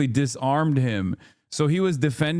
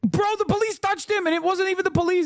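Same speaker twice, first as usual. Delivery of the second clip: much higher, fast exasperated outburst; heated correction. Real, not acted. Streamer correcting the cohost mid-argument, heated and fast. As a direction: cut him off, incensed.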